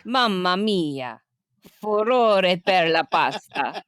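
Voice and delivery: slow, hammed-up Italian accent. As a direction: drawl it out like an Italian nonna. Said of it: "Excuse my Italian accent".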